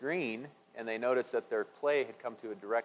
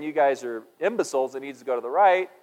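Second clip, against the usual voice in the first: louder irritated retort; mock angry wife; 3 semitones higher, 8 dB louder than usual. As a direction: snap at them like the annoyed wife. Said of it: "she's saying".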